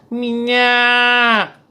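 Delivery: long held high street call; shrill kerosene-seller cry. Shown as a demonstration. Kerosene vendor hawking door to door, piercing drawn-out cry. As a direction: sweep up high and hold it, loud. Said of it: "begitu akhirnya melengking ke atas".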